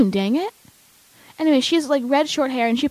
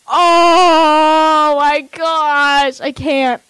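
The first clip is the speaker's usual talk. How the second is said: very slow, louder drawn-out squeal of joy; ecstatic gush; 3 semitones higher, 5 dB louder, much slower than usual. Real, not acted. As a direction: stretch it out, overwhelmed with excitement. Fangirling over the trailer.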